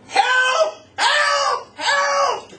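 high, loud, desperate screams; screaming for help. Retold or imitated. Boy chased by dogs screams to the crowd, desperate shout. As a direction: yell for help as if your life depends on it. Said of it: "I start screaming".

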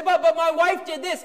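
high, loud stammering excuse; hammed-up blaming husband; mock whining husband. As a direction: whine the excuse like a caught husband.

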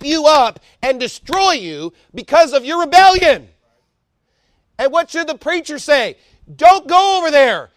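loud, high, slow hammering sermon; fiery preaching. Acted. Preacher warning backsliders about God's stumbling blocks, shouting. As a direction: pound each warning home from the pulpit.

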